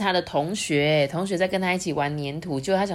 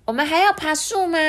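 high eager question; eager princess; 12 semitones higher, 4 dB louder than usual. Acted. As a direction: ask hopefully. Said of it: "艾莉诺就说".

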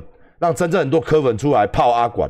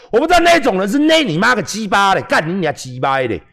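higher, louder cursing outburst; mock furious rant; 7 semitones higher, 3 dB louder than usual. Mocking angry fans who curse him, loud and high. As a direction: explode with mock outrage.

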